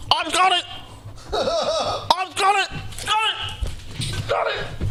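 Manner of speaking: very high, squeaky shout; squeaky cartoon voice. Shrill comic outburst.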